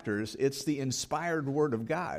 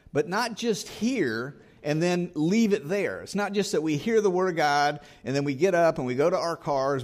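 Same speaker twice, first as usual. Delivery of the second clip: higher and louder, driving the point home; preaching at full force; 3 semitones higher, 3 dB louder than usual. Acted. Preacher urging the congregation to act, at full force. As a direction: press the congregation hard, rising with conviction.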